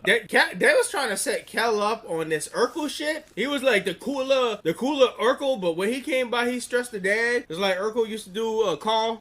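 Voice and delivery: high, loud excited storytelling. Real, not acted. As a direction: get hyped telling it. Excited telling.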